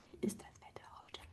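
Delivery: whispered question Wie ist das Wetter heute. Shown as a demonstration. Teacher drilling the question, now whispered. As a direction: breathe the question out without voice.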